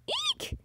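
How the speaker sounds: very high, small squeal; startled eek. Acted. Heroine losing her balance, acted startled squeal. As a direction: squeak in mock fright.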